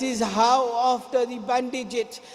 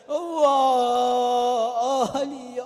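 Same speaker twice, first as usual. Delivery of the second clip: crying lament; much slower than usual. Real, not acted. Preacher cries.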